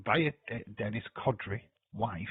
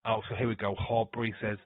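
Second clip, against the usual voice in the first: ordinary talk, nothing marked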